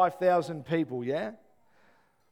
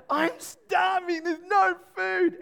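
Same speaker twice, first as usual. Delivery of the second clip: much higher, slow, loud whine; whining hungry kid; 12 semitones higher, 5 dB louder, much slower than usual. Retold or imitated. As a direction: wail it like a starving boy. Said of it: "When they whine".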